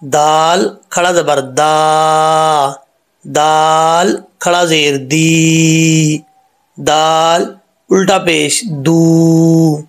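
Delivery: very slow, each syllable drawn out; slow recitation drill. Shown as a demonstration. Teacher drilling vowel marks with students, slow recitation. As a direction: spell it out slowly for the class. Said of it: "अब इसके ऊपर जो हरकत हैं वो पढ़ते हैं".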